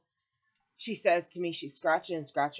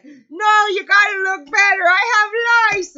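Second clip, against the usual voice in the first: much higher and louder, whining; whiny insistent mother; 13 dB louder, slower than usual. Retold or imitated. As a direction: whine it like the itchy old mother. Mother insisting, demanding closer look.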